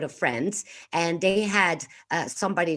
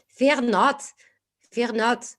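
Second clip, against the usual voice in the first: higher, louder French angel; French accent; 5 semitones higher, 3 dB louder, much slower than usual. Acted. Angel appearing to the co-host, French accent. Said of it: "the French angel".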